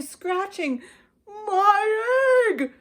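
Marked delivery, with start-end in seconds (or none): 1.3-2.7 much higher, loud cry; excited shout. A narrator's reading. Horton crying out as his egg hatches. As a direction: shout it with joy. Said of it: "shouted Horton".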